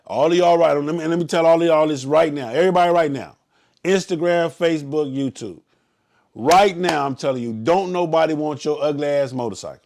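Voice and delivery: loud announcement to everyone; calling out. Real, not acted. Leader calling out to Instagram, Facebook and YouTube viewers, loud. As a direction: raise your voice to address the whole crowd.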